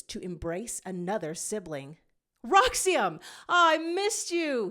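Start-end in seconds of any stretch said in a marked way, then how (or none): 2.4-4.7 higher and louder, overjoyed cry; excited exclaim. Acted. Zero greets his returned brother Roxium with an excited cry. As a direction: greet a lost brother with a burst of joy. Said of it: "he exclaims".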